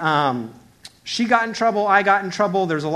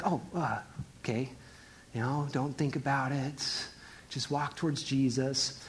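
much softer, hesitant muttering; quietly steady yourself; nervous self-talk; 4 semitones lower, 12 dB quieter than usual. Acted. Peter walking on stormy water, quiet nervous self-talk.

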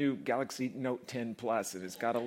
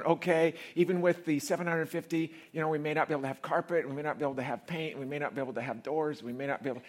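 worried rush; 4 semitones higher, 3 dB louder than usual. Retold or imitated. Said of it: "I was going".

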